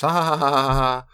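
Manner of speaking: loud, high burst of fake laughing; mock laughter. People laughing off taking out the trash, mock laughter. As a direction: scoff at the idea with a hammed-up laugh.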